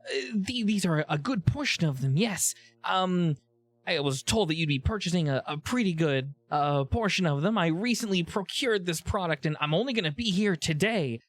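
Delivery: jittery seller stumbling through an explanation; halting, anxious character voice; nervous vendor. Acted.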